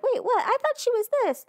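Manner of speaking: put-on high, puzzled child voices; imitating kids' surprised questioning; confused kids. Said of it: "the kids are like".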